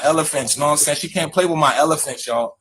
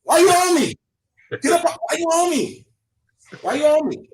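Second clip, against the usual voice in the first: much higher, hammed-up irritated protest; mock-annoyed club shout; 13 semitones higher than usual. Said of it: "I said".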